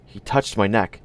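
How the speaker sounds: usual speaking voice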